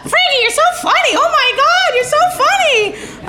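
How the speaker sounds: very high, laughing, delighted squeal; Sonia laughing. Retold or imitated. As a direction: squeal it like she can't stop cracking up. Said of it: "she'd always laugh".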